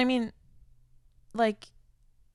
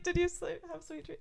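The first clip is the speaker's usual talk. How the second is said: high, mock-sweet sing-song that fades to soft; playfully mimicking her own lovey text; mock sweet reply; 9 semitones higher, 6 dB quieter than usual. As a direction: tease him with a much higher, sugary voice. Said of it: "and I was like".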